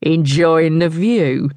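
slow, leering thug's question; drawn-out suggestive male voice; lecherous. A narrator's reading. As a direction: taunt with slow insinuation. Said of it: "lecherous chuckle came from the one closest".

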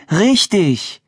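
high-pitched insistent prompt; demanding. A narrator's reading. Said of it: "forderte Anne ihn auf". (hoch und auffordernd)

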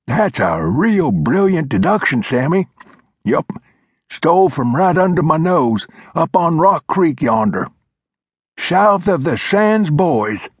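put-on high, reedy old-timer lecturing; old mountain man voice. A narrator's reading. Old Glover scolding Flintlock, high old-timer voice. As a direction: play the cranky old coot setting him straight.

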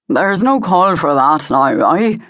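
lightly voiced wary Scottish laird, slow; easy now, a touch of calming a threat; Jamie, Highland laird. A narrator's reading. Highland laird coaxes the armed old woman, slowly.

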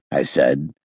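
ordinary delivery

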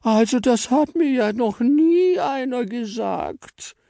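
very high, slow indignant gasp; be aghast at the insult; offended shock. Acted. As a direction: Frau Stern shocked at being called the fattest. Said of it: "plusterte erschrocken ihre Backen auf".

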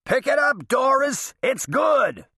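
yelled high, well above the narration; exasperated yell. A narrator's reading. Golfer yelling at a slow women's foursome to move on, high impatient yell. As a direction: holler it at a dawdling golfer. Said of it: "they'd force me to yell".

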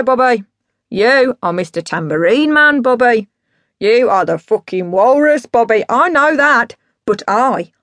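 loud, swooping boozy rant; drunk ranting dad. Acted. Drunk dad telling his idols who he is, grandiose. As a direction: build to a grand, self-important declaration.

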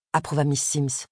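plain reading voice

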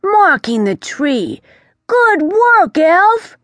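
proud of the little one; pleased praise. Acted. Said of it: "Marlin sounded pleased".

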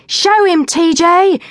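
high, loud cheer; cheering girl. A narrator's reading. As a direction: urge her to win. Girl cheers during contest.

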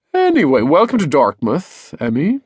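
high, sing-song welcome; cheery dad. A narrator's reading. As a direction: welcome her loudly as a chirpy father.

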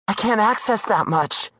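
high woman's voice, reluctant; hesitant Darla. Acted. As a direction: balk at the price.

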